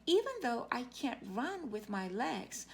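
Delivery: ordinary delivery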